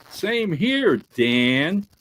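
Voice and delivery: put-on old-time radio father voice; father's voice. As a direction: stretch it like a radio drama actor. Father answers in radio scene.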